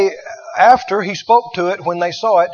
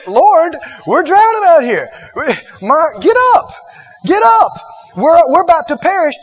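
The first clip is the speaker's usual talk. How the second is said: higher, louder panicked cry for help; panicked disciples; 7 semitones higher, 5 dB louder than usual. Retold or imitated. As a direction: shout it like men in a sinking boat. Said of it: "They said".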